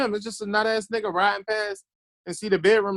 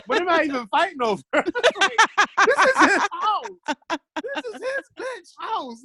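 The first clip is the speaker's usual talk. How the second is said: high voice breaking into laughter; laughing incredulity; 12 semitones higher, slower than usual. Real, not acted. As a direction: crack up while telling your own story. Cracking up retelling absurd standoff.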